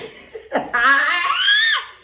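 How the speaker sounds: big high burst of laughter; shrieking laugh; loud laugh. Real, not acted. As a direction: crack up loudly at the joke. Laughs at own mock text.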